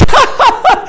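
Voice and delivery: high, loud mock guffaw; imitated big laugh. Waitress laughing at angry customer, high imitated guffaw. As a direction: burst out in high, forced laughter. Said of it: "And she goes".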